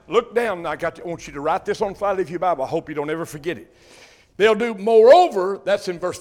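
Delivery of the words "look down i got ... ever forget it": normal talking voice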